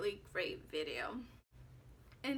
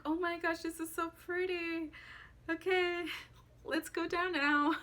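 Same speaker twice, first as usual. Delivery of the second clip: higher, bright rush of relief; excited relief; 6 semitones higher than usual. Retold or imitated. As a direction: gush then beg to go down.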